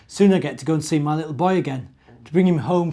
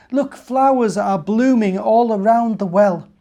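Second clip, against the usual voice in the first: higher, louder, wondering creature voice; animal character; 7 semitones higher, 10 dB louder than usual. Acted. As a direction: marvel at the flowers. Fox and snake marvel at flowers.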